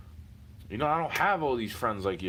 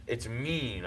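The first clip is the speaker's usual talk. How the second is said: ordinary talk, nothing marked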